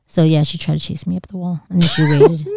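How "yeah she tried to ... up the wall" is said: ordinary talk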